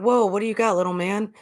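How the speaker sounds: mild mock surprise at the kid's pick; surprised dad.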